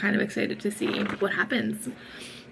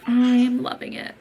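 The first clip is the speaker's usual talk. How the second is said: normal talking voice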